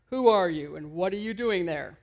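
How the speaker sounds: loud call from up on the wall; guard's yell down to the rabbi. Retold or imitated. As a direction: challenge from the turret. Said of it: "this voice yells down".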